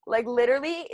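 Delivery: ordinary talk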